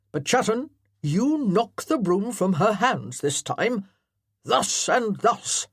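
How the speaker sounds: loud parade-ground colonel; commanding colonel. Acted. Colonel directing his amateur actors, loudly commanding. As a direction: bark orders like a drill officer.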